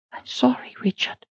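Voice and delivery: soft breath-only whisper. A narrator's reading. Head cook whispers the news to Richard. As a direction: whisper the bad news gently. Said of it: "she whispered".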